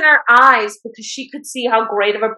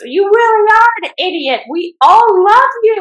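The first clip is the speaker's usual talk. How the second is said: much higher, louder on love, slowing down; pleading outburst; 9 semitones higher, 4 dB louder than usual. Retold or imitated. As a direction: beg him to come back, heart on sleeve. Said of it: "She goes".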